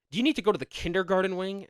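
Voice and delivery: high, quick mock telling-off; scolding teacher. Teacher scolding a giggling pupil in class, imitated reprimand.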